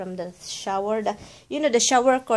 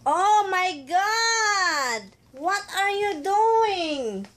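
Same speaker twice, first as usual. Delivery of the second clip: high, long drawn-out cry of dismay; exasperated scolding; 10 semitones higher, much slower than usual. Real, not acted. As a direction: stretch each word as you catch a naughty pet. Scolding the pet caught making mess.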